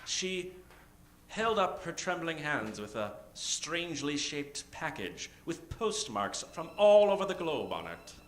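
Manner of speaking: ordinary talk, nothing marked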